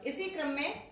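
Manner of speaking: ordinary talk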